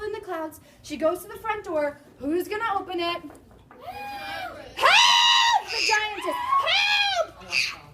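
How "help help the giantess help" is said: high, loud giant woman's cries; shouting giantess; giantess bellowing. Acted. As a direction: call out for help like a booming giantess. Giantess calling for a servant.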